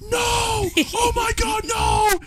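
very high-pitched mock scream; imitated screaming. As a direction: wail it like a friend in total shock. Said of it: "he was screaming".

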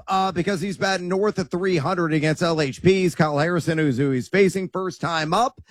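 ordinary talk, nothing marked

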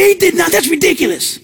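lightly voiced scoffing doubter; scoffing skeptic. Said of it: "they'll go".

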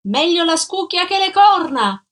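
high, loud parting shout. A narrator's reading. Adalgisa shouting after Gino from the railing, loud and high. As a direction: yell it down the stairwell. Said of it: "gridò lei affacciandosi alla ringhiera".

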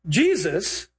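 ordinary talk, nothing marked

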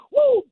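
high-pitched "woo" of fervor; ecstatic whoop. Real, not acted. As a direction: let out a sudden high whoop. Preacher overcome mid-sermon.

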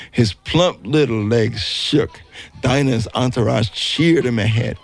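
usual speaking voice

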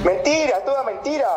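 high-pitched, insistent denial; emphatic denial. Real, not acted. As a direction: flatly reject the accusation, pitch jumping up.

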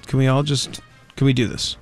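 ordinary talk

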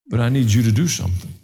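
ordinary talk, nothing marked

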